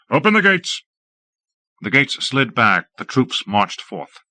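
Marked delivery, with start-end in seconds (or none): 0.1-0.8 higher, ringing order to open; lord's ringing command; commanding call. A narrator's reading. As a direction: call out to the gatekeepers. Lord commands the gates opened.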